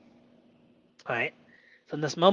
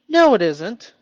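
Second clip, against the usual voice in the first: loud, higher mocking put-on voice; mean inner voice; 6 semitones higher, 10 dB louder than usual. His inner critic contradicting him, loud mock voice. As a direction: snap back as the harsh inner critic. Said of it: "voicing the doubting voice".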